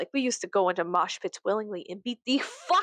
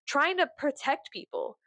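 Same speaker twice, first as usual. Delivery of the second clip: ordinary delivery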